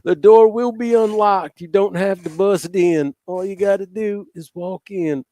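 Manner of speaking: high, slow, loud Trump voice; Trump impression. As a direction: drag it out as Trump.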